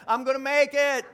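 loud, confident declaration; bold faith declaration. Shown as a demonstration. Preacher modeling what the congregation should say, loud and sure. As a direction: proclaim it like you mean it. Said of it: "why don't you just say that".

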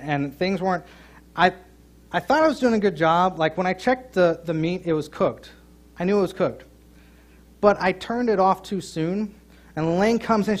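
ordinary talk

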